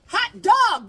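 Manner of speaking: high, loud cheer; excited shout of the kids. Acted. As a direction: whoop it like kids set free. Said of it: "the kids cried".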